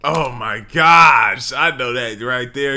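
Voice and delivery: loud, slow Oh my gosh; a loud, slow cry of disbelief at the text; excited outburst. Real, not acted. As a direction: explode with disbelief. Co-host erupts at the text.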